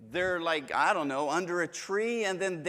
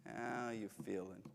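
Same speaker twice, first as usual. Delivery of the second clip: a much softer how you feeling; softer, gentle ask; 6 semitones lower, 12 dB quieter than usual. Shown as a demonstration. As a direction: ask it quietly, tenderly. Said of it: "tone of voice matters".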